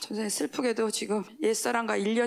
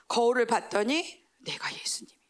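ordinary delivery

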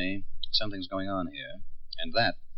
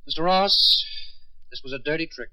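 startled outburst; 9 semitones higher, 4 dB louder than usual. Acted. Con man unmasked, outburst.

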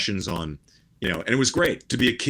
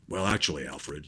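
low, quiet voice, sinking on the name; understated, hushed off-duty hero voice; Bruce Wayne in private; 6 semitones lower, 6 dB quieter than usual. Acted. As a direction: drop it down low and soft, confiding in the butler. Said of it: "he would talk like this".

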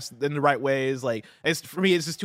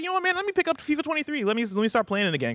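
高い声で早口に、はしゃいだ独り言; 急にテンションが上がって早口で; excited inner voice; 12 semitones higher, faster than usual. Retold or imitated. Excited inner voice deciding to play.

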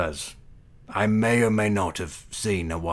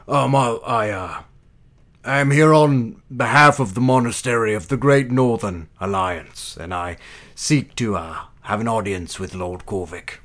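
grand, louder, in a put-on posh accent; formal put-on air; 4 semitones higher, 8 dB louder than usual. Envoy seeks audience with the lord in a posh accent. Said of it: "she kind of adopts a more formal air".

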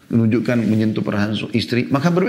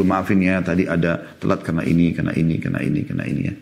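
gentle apology; 6 semitones lower than usual. Shown as a demonstration. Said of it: "Harus duduk dulu". (permintaan maaf yang lembut dan rendah hati)

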